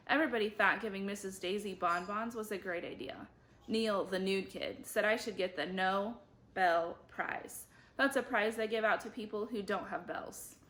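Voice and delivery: plain reading voice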